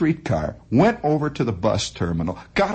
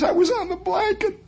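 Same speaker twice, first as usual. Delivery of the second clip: put-on girl's high voice. Girl voiced in retold story.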